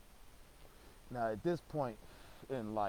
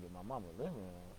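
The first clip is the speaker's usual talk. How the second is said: lower, softer disbelieving question; stunned echo; 4 semitones lower, 7 dB quieter than usual. Retold or imitated. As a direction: repeat it back under your breath in shock. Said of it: "I'm like".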